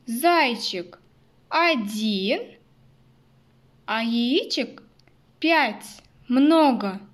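put-on bright emphatic summing up for kids; reading to a toddler. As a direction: stress "пять" and "Много" loud for little ones. Summing up for children. (как воспитательница малышам)

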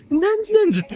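high mocking sing-song lilt; mock the corner's name in a high silly voice; teasing high-pitched parody.

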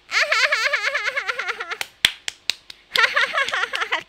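long, high burst of laughter; loud laughter. Real, not acted. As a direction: laugh hard at yourself. Laughs at her anime voice claim.

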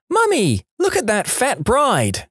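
high-pitched imitation of a small child piping up; piercing little child voice. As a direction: pipe up like a piercing little kid. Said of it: "piped up in my piercing little voice".

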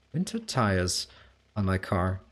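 ordinary delivery

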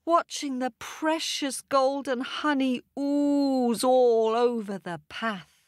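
usual speaking voice

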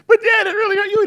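put-on high, loud pleading kid voice; a kid whining to his dad, high and loud; whiny child. Child complaining to his dad about getting hurt, high and whiny.

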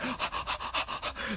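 quick, breathy gasps of excitement; airy rapid gasping; excited panting gasps. Retold or imitated. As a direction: pant as if overwhelmed. Acting out excitement at revelation.

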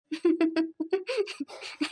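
amused chuckle, trailing down; long wordless laugh. Acted. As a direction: chuckle helplessly at a cheeky child. Aunt amused by the child.

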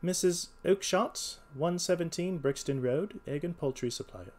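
detective reading an entry out deliberately; reading aloud. A narrator's reading. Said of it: "Just read it out to me".

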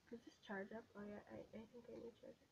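quick hushed whisper. Real, not acted. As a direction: keep it down, whisper. Hushed, someone nearby.

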